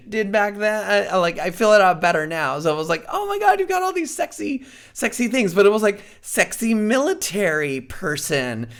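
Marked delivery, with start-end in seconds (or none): 3.1-5.5 high-pitched mock gushing over a find; excited gushing. Reacting to the friend's costume stash, excited gushing. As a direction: gush it in a much higher voice.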